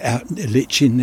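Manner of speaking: ordinary talk, nothing marked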